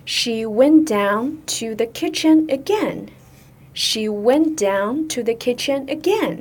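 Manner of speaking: slow, careful teacher's read-aloud; model sentence reading. Shown as a demonstration. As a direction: read it slowly for learners to follow. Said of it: "我们来看今天的句子". (慢速示范朗读)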